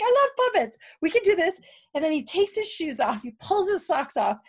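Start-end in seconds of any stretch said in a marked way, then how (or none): 0.0-1.6 high, loud, giddy delight; excited squeal. Retold or imitated. To the team, gushing about puppets with high giddy delight. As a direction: gush like a kid who loves puppets. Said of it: "and I was like".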